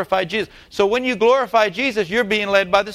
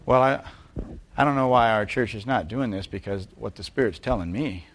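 mock know-it-all believer; 10 semitones lower, 6 dB quieter than usual. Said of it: "they begin to talk like this".

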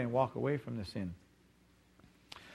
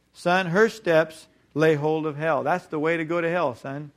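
preaching at full force; 5 dB louder than usual. Real, not acted. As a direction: lean in and hammer the warning. Preacher warning his son.

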